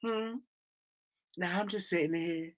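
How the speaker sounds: mock languid phone voice; sleepy voice. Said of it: "I hate the sleepy voice".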